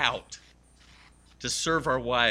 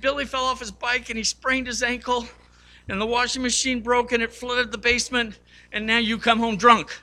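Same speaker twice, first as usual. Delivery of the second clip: much higher, louder tearful wife voice; upset wife; 9 semitones higher, 6 dB louder than usual. Retold or imitated. Wife to Bob after the kiss, much higher upset voice. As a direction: voice the wife piling up complaints, high. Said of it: "And she goes".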